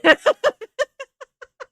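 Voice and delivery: loud cackle; loud high laugh. Real, not acted. Laughing loudly with her viewers. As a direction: burst into a big laugh.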